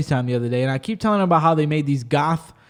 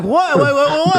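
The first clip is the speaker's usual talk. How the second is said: panicked confusion; 9 semitones higher, 4 dB louder than usual. Retold or imitated. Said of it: "I was like".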